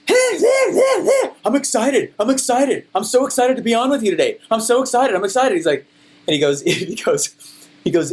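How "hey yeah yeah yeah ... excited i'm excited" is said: high-pitched, over-excited parody; mock American hype. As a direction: gush in a hammed-up hype-man frenzy. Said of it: "every one of you guys is like".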